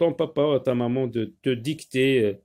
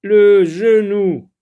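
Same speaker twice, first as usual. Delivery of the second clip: loud, slow, higher announced word; dictation style; 5 semitones higher, 10 dB louder, slower than usual. Shown as a demonstration. Teacher announcing a new word to pupils, loud dictation style. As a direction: call out the new word, drawn out.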